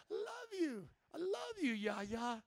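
high-pitched sing-out of affection; affectionate call. Real, not acted. Son-in-law calls to his mother-in-law from pulpit, high affectionate call. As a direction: coo it up high to family.

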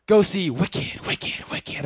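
slow ad tagline trailing into a whisper; mock theatre commercial, ending in a whisper; imitating the commercial. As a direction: say the slogan slowly then whisper the echo. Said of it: "It's like".

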